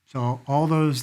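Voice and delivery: ordinary talk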